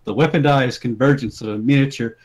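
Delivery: ordinary talk, nothing marked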